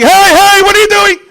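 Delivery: high loud cry of alarm; screaming. Acted. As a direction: shout it like panicked helicopter parts. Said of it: "gonna start screaming".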